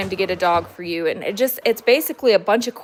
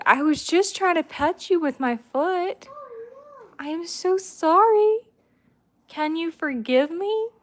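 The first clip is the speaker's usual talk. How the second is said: high pet-talk apology; playful pleading apology; 9 semitones higher than usual. Pretend. Owner to her offended dog, playfully begging forgiveness. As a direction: coo a mock-wounded plea in a high pitch.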